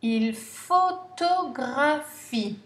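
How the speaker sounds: photographie said slowly and clearly; slow dictation. Shown as a demonstration. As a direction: read il photographie slowly for pupils. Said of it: "N'oubliez pas le E muet".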